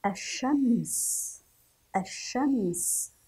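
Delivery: "الشمس" as ash-shams, slow, with a held sh; silent lam. Shown as a demonstration. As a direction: say "الشمس" slowly, dropping the l. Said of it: "لم نذكر اللام". (نطق "الشمس" ببطء بلام شمسية)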